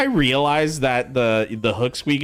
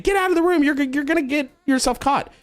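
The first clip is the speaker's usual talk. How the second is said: mock angry mom yell, much higher; imitating mom snapping at him, pitched way up; retold yell. Mom ordering son out after hook pokes, mock yell. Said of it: "started yelling and said".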